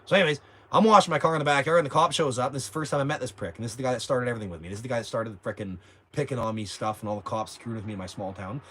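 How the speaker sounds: usual speaking voice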